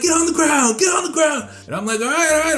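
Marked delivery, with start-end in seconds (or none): 0.0-1.5 high-pitched mock shouted order; armed guard's command. Guard ordering the airman down at gunpoint, shouted command. 2.0-2.6 panicked surrender. Retold or imitated. Surrendering to armed guard.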